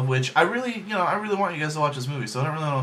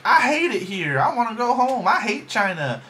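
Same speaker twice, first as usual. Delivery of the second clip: put-on whiny kid; 9 semitones higher, 3 dB louder than usual. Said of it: "being like".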